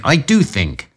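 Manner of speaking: firm stress on think; with emphasis. A narrator's reading. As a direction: lean hard on think. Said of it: "said Holmes with emphasis".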